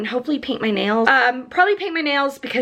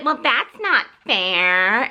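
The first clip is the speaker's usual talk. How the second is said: slow, mock-whiny complaint; slow sulky mimicry of a whiny hero; mock whine; much slower than usual. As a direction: drag it out like a sulking kid. Mocking the whiny character.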